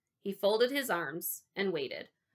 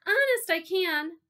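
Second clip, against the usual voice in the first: high, loud, slow plea; pleading boy, voice pitched up; calling back; 11 semitones higher, 6 dB louder than usual. A narrator's reading. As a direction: insist loudly across the room. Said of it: "I called back to the table".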